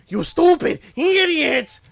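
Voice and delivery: high, loud, drawn-out insult; mock furious yell. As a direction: yell it at the fool. Imagined insult at contestant.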